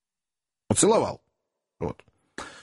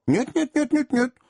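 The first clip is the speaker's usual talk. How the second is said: quick, high run of eager no's; hasty protest; 10 semitones higher than usual. A narrator's reading. Widow hurriedly promising Tom not to scream, eager high nos. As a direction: rush to reassure him, voice jumping up. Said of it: "поспешно сказала вдова".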